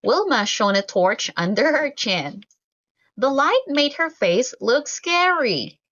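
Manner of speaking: ordinary delivery, nothing marked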